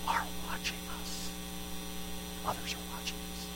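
a preacher whispering for weight; hushed repetition. Acted. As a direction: drop to a whisper to let it sink in. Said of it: "repeated in a hushed voice".

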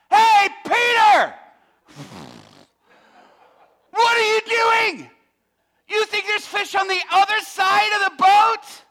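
mocking shout. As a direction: heckle Peter from shore. Crews heckling from shore.

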